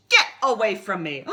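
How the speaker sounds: loud shout. Acted. Rainbow Fish shouting the little fish away. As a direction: drive him off with a yell. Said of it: "cried the Rainbow Fish".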